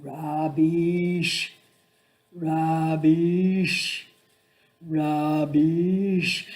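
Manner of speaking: calling down the corridor, each word held. Retold or imitated. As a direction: call out to her before you reach the room. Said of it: "I would call out".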